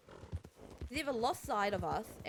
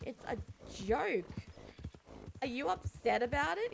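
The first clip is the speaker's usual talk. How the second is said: mock exasperated protest; 6 semitones higher than usual. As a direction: push back as if unfairly accused. [name] defends her joke to Jin.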